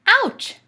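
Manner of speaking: high, loud yelp of ouch; Dad stepping on a crab, yelping; pained ouch. Acted. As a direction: yelp as if pinched. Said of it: "said Dad".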